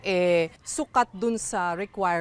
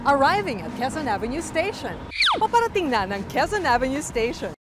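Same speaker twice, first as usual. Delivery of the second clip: raised, measured station announcement; train station announcement; 5 semitones higher than usual. Shown as a demonstration. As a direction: announce it over the platform speakers. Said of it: "mag-anunsyo sa MRT".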